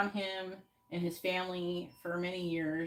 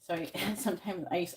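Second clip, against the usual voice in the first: normal talking voice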